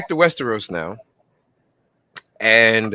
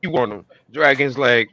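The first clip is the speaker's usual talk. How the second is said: normal talking voice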